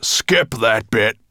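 slow, loud growled interruption. A narrator's reading. Dragon cutting off his storyteller, growling loudly. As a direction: growl to cut the story short. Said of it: "growled Nettlebrand".

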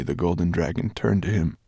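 plain reading voice

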